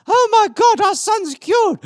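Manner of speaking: very high, loud, slowed mock mom shriek; overjoyed mom. Mother shrieking that her son is cured, very high. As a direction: shriek for joy like a hammed-up mother. Parent voiced in story.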